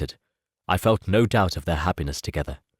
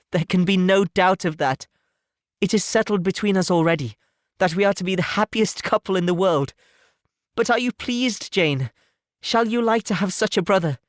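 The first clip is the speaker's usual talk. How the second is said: put-on higher, confident young lady; happy Elizabeth; 10 semitones higher than usual. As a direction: settle her doubts, then ask hopefully. Elizabeth reassures Jane.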